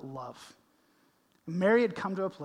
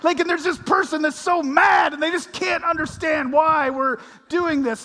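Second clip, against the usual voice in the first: mock exasperation; 10 semitones higher, 10 dB louder than usual. Describing mad council member.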